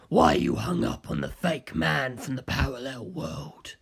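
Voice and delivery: puzzled and unhurried, a bit low; Kaz's voice. Acted. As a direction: Kaz confused by the detective, low and slow. Narrator voices Kaz.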